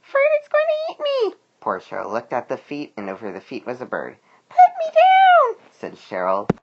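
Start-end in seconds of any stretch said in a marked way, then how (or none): 0.0-1.3 very high, loud squeaky worm voice; Cheryl crying out. A narrator's reading. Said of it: "she cried". 4.5-5.6 loud, very high squeal, drawn out slowly; Cheryl protesting. A narrator's reading. Grabbed worm protests to bird.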